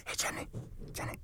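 whisper. Pretend. Gas thief whispering again.

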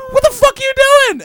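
high, loud shocked yell; loud high mock outrage; retold yell. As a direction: shriek it like she caught you. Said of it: "she goes".

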